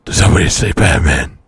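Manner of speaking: deep gravelly caped-crusader growl; low loud Batman rasp. Acted. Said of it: "That was actually very good".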